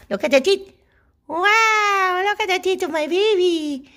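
high-pitched doting admiration. Real, not acted. Gushing over her dog's teeth.

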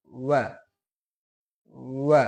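ওয়া said short with a clear open a; waw with fatha. Shown as a demonstration. Teacher shows waw with zabar to students. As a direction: pronounce ওয়া crisply with zabar. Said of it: "জবর দিয়ে".

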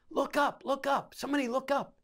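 high, urgent call, not a full shout; retold calling out. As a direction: imitate a soldier calling out under fire.

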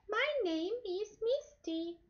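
put-on very high, slow little-girl voice; teacher voicing the girl puppet Misty for the class. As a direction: squeak it out like a shy little girl. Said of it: "it's a girl".

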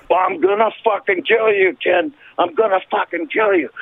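high-pitched retold fury; worked-up, acted-out rage; enraged threat. Acts out his threat back.

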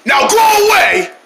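high, drawn-out yell; acted angry shout. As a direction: shout it slowly to chase someone off. Chasing the elephant off.

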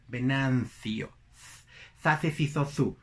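slow "Venancio" with th, then "Zace, cizo, zu" in th; European Spanish Z. Shown as a demonstration. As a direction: drill the th in "Venancio". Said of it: "Es así como van a tener que pronunciar". ("Venancio" con Z española)

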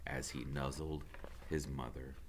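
ordinary delivery, nothing marked

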